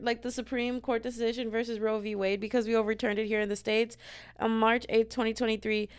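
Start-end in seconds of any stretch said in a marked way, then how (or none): none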